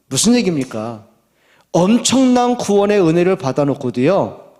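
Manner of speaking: ordinary talk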